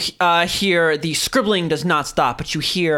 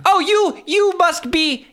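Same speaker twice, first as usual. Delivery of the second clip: much higher strained voice; stuck high pitch; 10 semitones higher than usual. Acted. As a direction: squeeze it out high and tense. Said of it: "high, strained voice".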